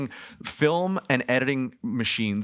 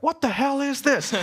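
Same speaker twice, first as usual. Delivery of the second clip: high, incredulous squawk of a bystander; mock startled passerby; 3 dB louder than usual. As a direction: play a shocked stranger on the street, pitched way up. Americans reacting to street stunt.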